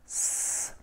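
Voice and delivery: a long unvoiced sss; S hissed out alone; snake hiss. Shown as a demonstration. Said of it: "comme le serpent".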